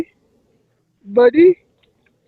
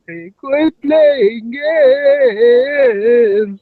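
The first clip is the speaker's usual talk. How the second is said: slow, drawn-out tearful plea; crying voice; much slower than usual. Acted. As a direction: wail it slowly. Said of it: "this crying voice".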